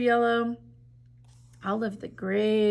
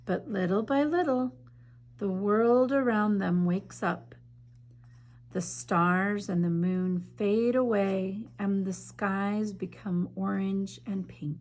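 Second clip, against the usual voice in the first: story narration. A narrator's reading. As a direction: read it like a bedtime story to children. Reads picture book aloud.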